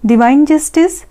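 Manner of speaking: ordinary talk, nothing marked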